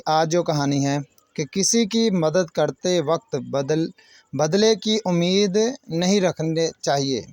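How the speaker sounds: plain reading voice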